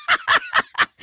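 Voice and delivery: shrill villain giggle, sharply rising; high giggle. Acted. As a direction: cackle up high with mocking glee. Villain laughs at player.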